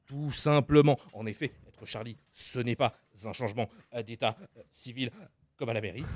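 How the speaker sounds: Jamy impression. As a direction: explain gravely like a science show host, with sound effects. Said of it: "Fred et Jamy".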